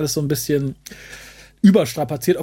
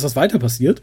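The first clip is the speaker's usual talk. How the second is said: usual speaking voice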